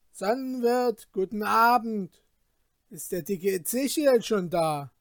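loud, lordly greeting called out; calling out. Acted. Rich Peter calls out to the Sonnenwirt, loud and grand. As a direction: call out grandly across the yard.

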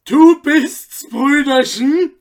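high, loud bitter mock greeting; bitter cry. A narrator's reading. Anton greeting the magistrate, a loud bitter jeer. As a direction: fling it out with bitter scorn. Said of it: "rief nun der Schuhflicker bitter".

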